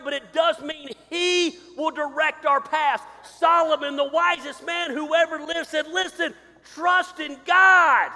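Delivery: loud, high, slow preaching with drawn-out scripture; full-force preaching. Acted. Pastor pleads with his congregation, loud slow preaching. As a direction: thunder the verse out, stretching every word.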